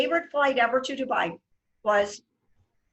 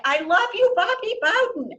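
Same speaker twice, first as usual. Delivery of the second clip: high, louder burst of adoration; gushing fan; 9 semitones higher, 5 dB louder than usual. Retold or imitated. Fan hugging her hero, gushing with excitement. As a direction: gush it out while hugging him. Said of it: "I throw my arms around him".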